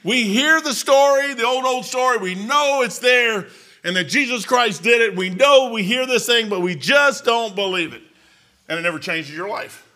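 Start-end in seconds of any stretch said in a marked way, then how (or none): 0.0-8.0 high, loud, slow preaching. Acted. Preacher telling the old story, loud and slow. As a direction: swell it over the music.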